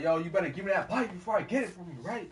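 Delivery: mock tough-kid threat; threatening demand. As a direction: bark the threat at him. Bully threatening over bike.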